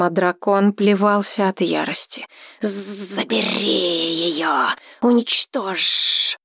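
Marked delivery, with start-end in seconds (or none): 2.6-6.4 slow otherworldly dragon demand. A narrator's reading. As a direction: drag out each word like a beast. Dragon voice in his mind.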